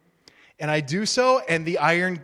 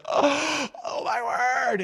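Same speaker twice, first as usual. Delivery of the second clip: high, breathless excited "oh my word"; excited gasp; 8 semitones higher, much slower than usual. Retold or imitated. Rhoda hearing Peter at the door, high excited gasp. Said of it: "she's like".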